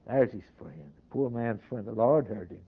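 low, softened pulpit voice; hushed repeat. Real, not acted. Preacher dwelling on the poor man's friend, hushed. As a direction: drop low and soft for reflection.

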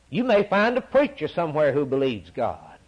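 usual speaking voice

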